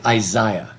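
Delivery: Isaiah said as eye-ZYE-uh, British style. Shown as a demonstration. Teacher showing the class the British Isaiah, eye-ZYE-uh. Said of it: "how the British pronounce the name Isaiah".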